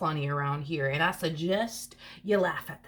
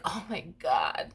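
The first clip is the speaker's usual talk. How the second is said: drawn-out acted disbelief; hammed-up slow astonishment; mock dramatic; slower than usual. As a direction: play up the drama, stretching the words. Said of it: "they're very dramatic".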